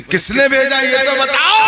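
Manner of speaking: high, loud demand; loud high challenging question; demanding jinn chief. Retold or imitated. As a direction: insist on knowing who sent him.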